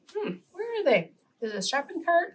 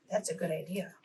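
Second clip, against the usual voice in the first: much softer, almost to herself; soft praise; 4 semitones lower, 10 dB quieter, faster than usual. Shown as a demonstration. Teacher praising a child's answer, very soft. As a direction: drop the voice right down. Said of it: "praising the listener's answer".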